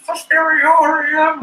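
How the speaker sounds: very high, loud, drawn-out mock wail; imitated eerie proclamation. Film fan mimicking her dramatic declaration, high drawn-out wail. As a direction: stretch it out in a piercing put-on voice.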